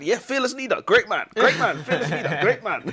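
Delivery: high praise through laughter; hammed-up, laughing adulation; mock praise. Acted prisoner praising Saddam, laughing.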